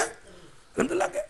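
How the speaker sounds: a mocking, nagging echo of the question; mock scolding. Father nagging his son, mock scolding in a high voice. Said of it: "جیسے ہم کہتے ہیں اپنے بیٹے".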